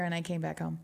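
usual speaking voice

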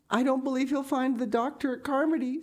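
high, tearful voice; a despairing tearful voice; sobbing. A narrator's reading. Diana fearing no doctor will come, high and tearful. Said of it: "sobbed Diana".

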